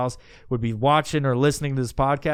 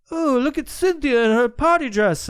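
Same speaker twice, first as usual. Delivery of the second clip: high, sugary mock-sweet voice; mocking a creepy handler's cooing; mock doting handler; 9 semitones higher, 4 dB louder than usual. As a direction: gush like a fake proud relative.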